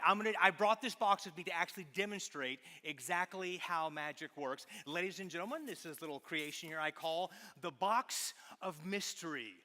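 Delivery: ordinary delivery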